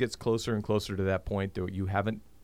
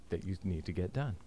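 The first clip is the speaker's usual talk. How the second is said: normal talking voice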